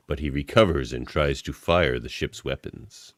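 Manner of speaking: ordinary delivery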